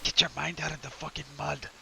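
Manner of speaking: high, soft, quick reproach; scolding character voice. Acted. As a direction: scold under your voice, high and fast. Character scolds companion for innuendo.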